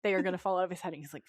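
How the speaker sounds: normal talking voice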